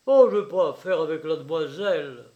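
loud, brisk, blustering denial; would-be brutal. A narrator's reading. Father denying dealings to his son, would-be brutal. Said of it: "avec un accent qu'il voulut rendre brutal et qui ne fut que troublé".

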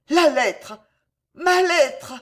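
loud, high horrified cry; hoarse cry. A narrator's reading. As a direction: cry out as if it escapes the throat. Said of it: "un cri rauque s'échappa de sa gorge".

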